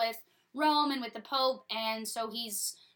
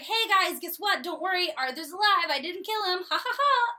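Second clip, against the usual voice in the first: higher, louder, chirpy good-news voice; cheerful Hubert; 7 semitones higher, 6 dB louder than usual. Retold or imitated. Hubert cheerily announcing Arthur lives, higher and louder. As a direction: bounce in with happy news. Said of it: "he's like".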